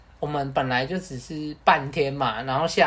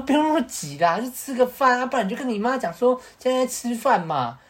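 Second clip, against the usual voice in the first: coaxing; 8 semitones higher than usual. Retold or imitated. Coaxing friend to stay out.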